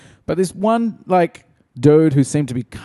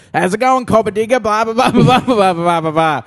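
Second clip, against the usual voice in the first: much higher, louder mock Aussie bloke; granite bloke's greeting; 9 semitones higher, 4 dB louder than usual. A granite-like customer greets the cashier, higher and louder. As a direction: ham up a big rough customer rattling on. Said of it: "just immediately goes".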